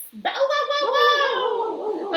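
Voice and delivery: high, loud barking woofs; a big dog chasing the cat, loud barks. Acted.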